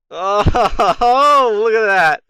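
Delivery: loud, high laughing outburst; real burst of laughter at defeat; laughing disbelief. Real, not acted. Player sees the battle lost, laughing in disbelief.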